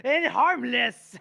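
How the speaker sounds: high, laughing scorn; mock her, cracking up mid-line; sneering villain voice. Poisoner lecturing his assistant, gleeful sneer. Reading Morvir mocking Day.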